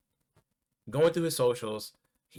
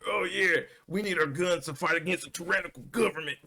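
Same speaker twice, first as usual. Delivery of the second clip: mocking gun owners; 6 semitones higher, faster than usual. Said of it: "the two A people who are like".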